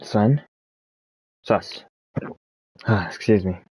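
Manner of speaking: hiccuping through the words; speech broken by hiccups. Real, not acted. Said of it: "Got the hiccups".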